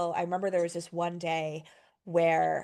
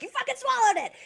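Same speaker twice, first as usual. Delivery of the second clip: much higher, shrill-sounding alarmed cry; panicked outburst; 4 dB louder than usual. Retold or imitated. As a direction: realise with horror he swallowed it. Panicked discovery the dog swallowed it.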